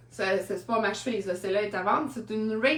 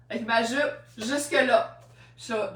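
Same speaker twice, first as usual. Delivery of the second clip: normal talking voice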